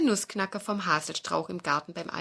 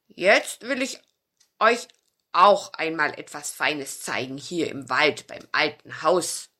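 put-on louder, proud old dog; old dog Bauz; 3 semitones lower, 6 dB louder than usual. Old dog offers children a treat, louder dog voice. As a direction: announce a surprise like a kindly old dog.

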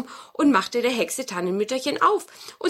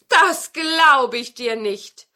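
loud, slow, raised retort; disbelieving; 4 semitones higher, 8 dB louder, much slower than usual. Acted. Grandmother doubts the wizard at her door, emphatic disbelief. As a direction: answer slowly and emphatically.

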